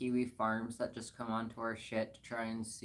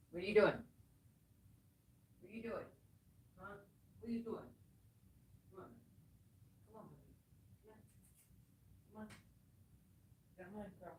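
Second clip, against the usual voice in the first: soft, high, gentle coaxing; coaxing a wild bird; 8 semitones higher, 11 dB quieter, much faster than usual. Real, not acted. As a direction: gently coax the animal closer. Coaxing pet softly.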